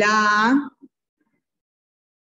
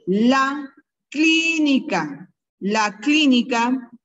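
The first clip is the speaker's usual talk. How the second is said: each word drawn out slowly for the class; teacher dictating slowly; slow dictation; 3 semitones higher, slower than usual. Shown as a demonstration. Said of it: "voy a ir dictando".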